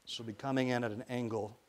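plain reading voice

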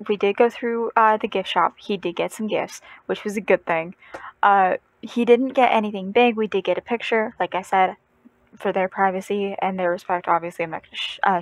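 ordinary talk, nothing marked

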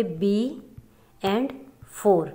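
"B" and "four" each set apart by a pause; syllable by syllable. Shown as a demonstration. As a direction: count out "B", then "four", as separate syllables. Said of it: "divide करते हैं syllables में". ("B" और "four" को अलग-अलग, रुक-रुककर)

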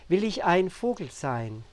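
measured, emphatic poetry reading with deliberate pauses; poem recitation. Acted. Poet reads an emotional poem to viewers, solemnly reciting. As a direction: lift each questioning refrain, then let it fall.

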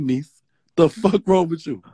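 usual speaking voice

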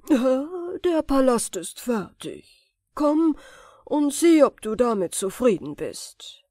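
a mighty servant proudly presenting; genie voice. A narrator's reading. Genie announces the finished palace.

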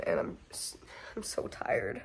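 exhausted whisper, barely voiced; tired whisper. Real, not acted. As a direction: let it fade to a weary whisper. Sick and exhausted vlogger.

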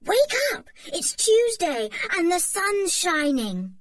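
excited wake-up call. A narrator's reading. Julian wakes Anne on holiday morning, excited call. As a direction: rouse your sister gleefully.

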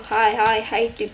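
ordinary talk

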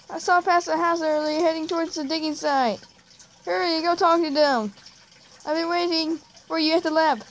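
high, urgent cartoon voice; excited friend character. Jake sends friend to the digging site, high urgent voice. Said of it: "I literally cannot do any of these voices".

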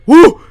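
high, loud "woo" yelp; startled whoop. Real, not acted. Gamer startled mid-line by the game, high whoop. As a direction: cut yourself off with a yelp. Jump scare while reading.

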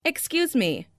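ordinary talk, nothing marked

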